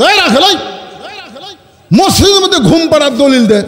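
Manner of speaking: high, indignant challenge; angry demand. Acted. Umar to the shepherd over sleeping in the mosque, angry. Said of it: "চেহারা লাল করে".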